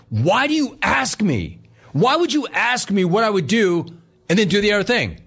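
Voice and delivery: hammed-up exasperated father; imitating a furious dad. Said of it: "Dude, he was furious".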